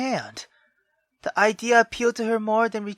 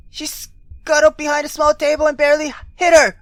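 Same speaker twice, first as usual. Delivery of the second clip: normal talking voice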